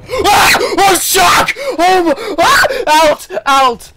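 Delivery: high, loud panicked shrieks; terrified screaming. Real, not acted. A shark surprises the diver, real terrified screaming. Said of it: "I think I screamed like a girl".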